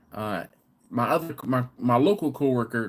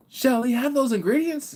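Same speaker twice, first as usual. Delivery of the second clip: high, loud, prim question; mock British server; 13 semitones higher, 4 dB louder than usual. Pub server asking about ingredients, high and prim. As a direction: play the polite pub server. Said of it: "And it's like".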